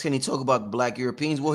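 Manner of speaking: ordinary delivery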